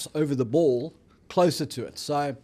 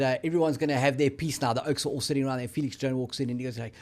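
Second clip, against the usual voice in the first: ordinary delivery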